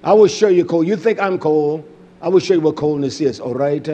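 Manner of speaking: ordinary talk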